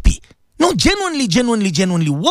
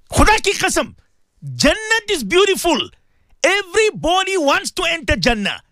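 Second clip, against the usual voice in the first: much higher, ringing preacher's voice; fervent high preaching; 14 semitones higher than usual. Real, not acted. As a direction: lift it high and sing out the faith.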